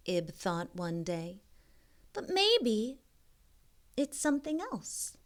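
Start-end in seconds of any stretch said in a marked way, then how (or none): 2.1-5.2 put-on wondering girl voice. Ib wondering hopefully.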